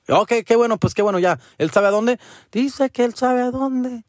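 high mock voices trading lines; mock phone chat. Son acts out checking the guide knows the way, high voices. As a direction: play both sides of the call high.

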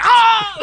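loud high yelp, like a sudden pop; sharp yelp of pain. Retold or imitated. Comedian's guy crossing his legs, high pained yelp. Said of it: "He's like".

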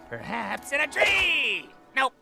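high, drawn-out pouncing cry; sudden exclamation. Acted. Searcher pouncing on the tree hiding place, exclaiming.

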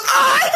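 high-pitched comic shriek; mock frightened scream. Wife sees the knife, mock scream. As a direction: squeal in hammed-up terror. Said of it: "She starts freaking out".

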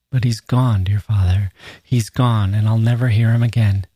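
ordinary delivery

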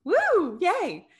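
high, loud whoop; excited cheer. Real, not acted. Cheering co-host.

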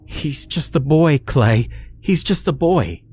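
high-pitched, anxious woman's plea; distressed woman, pitched well up; muttering, pleading woman. A narrator's reading. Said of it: "Missus Louise kept muttering".

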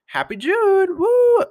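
giddy, high-pitched holiday cheer; excited cheer. Real, not acted. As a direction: burst into a celebratory woo. Repeats greeting as excited cheer.

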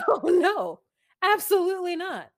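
loud laughing no way; laughing emphatic no; laughing refusal. Real, not acted. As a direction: laugh it off loudly.

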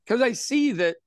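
usual speaking voice

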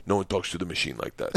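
low, soft retold threat; quiet menace. Big Igor defending student against teacher, quietly menacing. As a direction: drop down and warn her.

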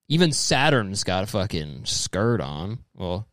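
ordinary talk, nothing marked

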